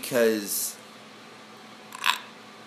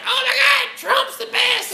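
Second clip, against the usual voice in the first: much higher, shrieking gushing fan voice; mock excited fan; 4 dB louder than usual. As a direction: squeal it like a starstruck supporter. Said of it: "Everybody's like".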